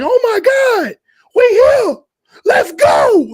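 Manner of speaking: high, loud, shouting with excitement; screaming with joy, voice cracking; overexcited shout. Retold or imitated. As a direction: yell it like a first-timer who just arrived. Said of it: "like that".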